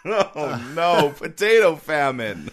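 slow, hammed-up Irish wail; copying a cartoon Irish accent; Irish accent, imitated. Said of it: "of the Irish touchstone".

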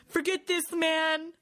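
high panicked quitting; a scared crewman bailing out; panicked Bailey. Retold or imitated. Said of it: "And Bailey's like".

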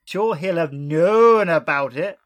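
put-on an Irish accent with a long stretched known; a playful Irish lilt, drawn out slowly. Hosts quoting Mitchell in an Irish accent. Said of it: "Aidan Turner? Is that you?".

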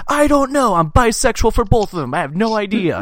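high, loud retold kid outburst; imitating a kid at wit's end; exasperated kid outburst. Retold kid pressed to choose.

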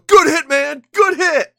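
high, loud, friendly shout; cheery mock shout. Host imitating Andrew Luck praising a defender, shouting cheerfully. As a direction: yell it like a happy quarterback just sacked. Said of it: "grab the defender and go".

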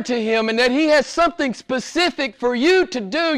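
impassioned high-pitched sermon delivery; sustained high, exhorting preacher voice; full-force preaching. Acted. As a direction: pour it out fervently to the congregation.

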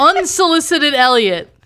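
laughing. Real, not acted. Teasing a guest's interjection.

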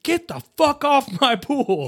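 high, slow, laughing shriek; frantic yell. Retold or imitated. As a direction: shriek it in mock panic. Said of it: "when she's like".

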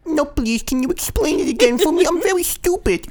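put-on very high, squeaky baby voice; hammed-up helpless little simpleton; mock stupid baby. Answering a condescending question, mock stupid baby voice. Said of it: "What character was that?".

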